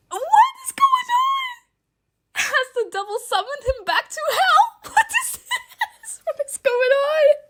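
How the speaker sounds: high, half-laughing disbelief; shocked laughing cry. Real, not acted. She cries out when Kane vanishes, high and half-laughing. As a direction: squeal it out, laughing and stunned. Angel vanishes mid-fall.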